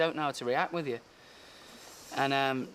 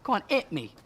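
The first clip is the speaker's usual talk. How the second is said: high, squeaky taunt; high-pitched goading, hammed up; mock dare; 13 semitones higher than usual. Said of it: "And I'll go".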